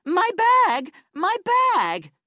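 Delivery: high, loud cry of alarm; panicked shouting. A narrator's reading. Woman waking to find her bag missing, shouting in alarm. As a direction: shout it out high, as a woman who's been robbed. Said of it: "she shouted".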